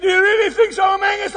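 loud and high, eager question; young farmer shouting back eagerly; excited shout. Retold or imitated. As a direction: call it out full of excitement. Excited shouted dialogue retold.

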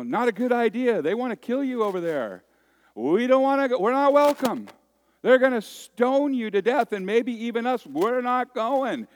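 high, loud, frantic pleading; panicked disciples. Retold or imitated. Disciples pleading with Jesus not to go, loud and frantic. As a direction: beg Jesus not to go, alarmed. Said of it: "his disciples are going".

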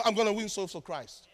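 ordinary delivery

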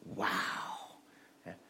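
hushed mock amazement; kids' soft mock-fascinated action line. Shown as a demonstration. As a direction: say it softly as if fascinated. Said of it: "You have to go".

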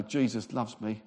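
low, soft, glum imitation; mock a joyless believer sharing good news; miserable. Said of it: "they look really miserable".

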